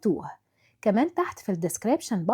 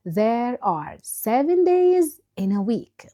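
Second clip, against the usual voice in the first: there are seven days in a week said slowly and clearly; slow model answer; 3 dB louder, much slower than usual. Shown as a demonstration. Teacher reads the dialogue answer slowly to the class. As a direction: spell the answer out slowly for pupils. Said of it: "Listen the following dialogue".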